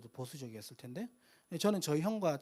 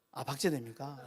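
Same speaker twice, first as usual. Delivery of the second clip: ordinary delivery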